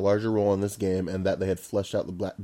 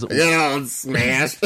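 drawn-out name call; 4 semitones higher, 3 dB louder, much slower than usual. Pretend. Mock announcing the name.

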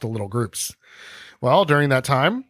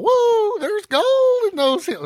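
much higher, slow hammed-up prospector whoop; gold rush whoop; slower than usual. Host plays a prospector spotting gold, hammed-up whoop. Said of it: "And then he's all".

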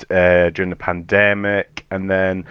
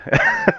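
high burst of laughter. Real, not acted. Guest laughs at own joke.

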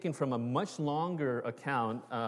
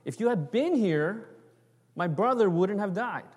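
Martha's reproach, raised and a little louder; grieving sister; 6 semitones higher, 3 dB louder than usual. Retold or imitated. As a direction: plead with Jesus, a touch reproachful. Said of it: "She says".

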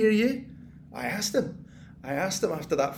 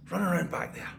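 whisper; 3 semitones lower, 4 dB quieter than usual. Acted. Taunting hidden vermin in the walls.

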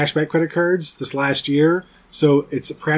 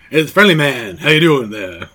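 friendly man bit; 2 semitones lower than usual. Retold or imitated. Recalling a Louis C K bit, mock friendly-man voice. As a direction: put on a comic friendly-man greeting. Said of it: "The Louis C K bit, but the friendly man?".

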